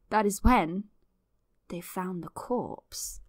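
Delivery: slow, dropping into a hush; a mock-ominous storyteller hush; spooky reveal.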